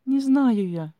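high, loud wailed denial; helpless high-pitched cry; tearful wail. A narrator's reading. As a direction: wail it on the edge of tears. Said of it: "По щекам опять потекли слёзы".

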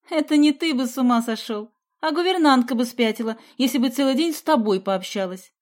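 loud, mocking put-down; snorting scorn. A narrator's reading. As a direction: scoff back at a classmate. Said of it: "фыркнула Соня".

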